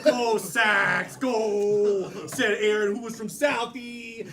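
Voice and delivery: loud, hammed-up Boston sports fan; Boston accent. Aaron from Southie cheering on the Sox, thick Boston accent. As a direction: holler it like a Southie guy.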